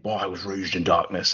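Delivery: low, muffled mock Bane. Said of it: "and he's saying".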